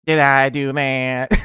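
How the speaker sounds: slow put-on nerdy voice, x0.5; nerdy kid caught in a mistake, slow; Steve Urkel. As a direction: drag out the sheepish catchphrase. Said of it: "he would go".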